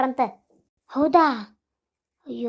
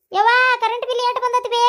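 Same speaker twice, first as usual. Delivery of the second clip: high, loud call across the house; shouting to mother; 9 semitones higher, 4 dB louder than usual. Acted. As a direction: yell for your mother from another room. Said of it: "ಯಾಕೆ ಚೀರಾಕತ್ತಿ?".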